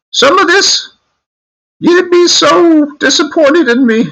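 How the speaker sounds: hammed-up falsetto British granny; British grandma. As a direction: wail it as a very high-voiced British grandmother. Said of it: "she was always taking off".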